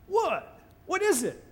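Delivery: high, baffled what is it; puzzled what. Acted. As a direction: ask it high in total confusion. Israelites puzzled at the manna.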